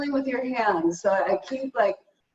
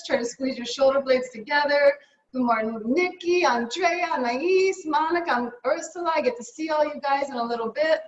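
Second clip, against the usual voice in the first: ordinary talk, nothing marked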